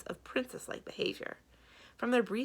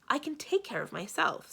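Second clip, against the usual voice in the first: higher, sharp retort; snapped; 8 semitones higher than usual. A narrator's reading. Samarine answers Mendenbar's warning, snapping. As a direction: bite back, offended. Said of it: "Samarine snapped".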